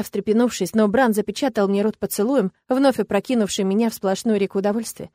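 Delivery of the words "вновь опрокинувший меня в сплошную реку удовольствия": usual speaking voice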